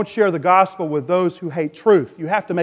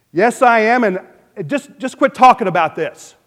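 louder, exasperated mock brush-off; irritated retort; 5 semitones higher, 6 dB louder than usual. Preacher imitating his stepmother refusing gospel talk, irritated retort. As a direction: imitate someone fed up, cutting off the subject. Stepmother snapping back in retold exchange.

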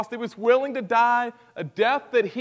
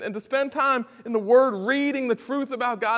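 ordinary talk, nothing marked

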